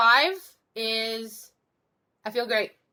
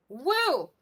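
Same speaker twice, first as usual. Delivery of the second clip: too much caffeine; 4 semitones higher than usual. Real, not acted. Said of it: "the caffeine has kicked in too much".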